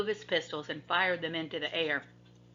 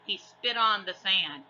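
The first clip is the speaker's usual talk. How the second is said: plain reading voice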